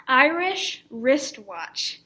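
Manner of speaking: slow, emphatic "Irish wristwatch", each word set apart; clearly articulated. Shown as a demonstration. Teacher introducing a tongue twister, clearly articulated. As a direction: make "Irish wristwatch" big and crisp, drawn out. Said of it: "is to clearly speak them".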